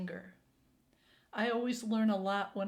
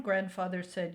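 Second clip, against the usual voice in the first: usual speaking voice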